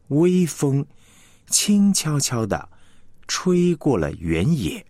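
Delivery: 微风 … 轻悄悄地 … 吹过原野 in three slow phrases; with pauses. Shown as a demonstration. Teacher shows the sentence with proper pauses. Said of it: "那你再听一次哈".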